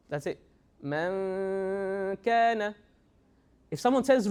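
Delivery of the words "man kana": slow "Man kana." with a long nasal hum; elongated ghunna. Shown as a demonstration. Teacher showing how to add ghunna, elongated nasal "Man kana.". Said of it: "elongate that sound".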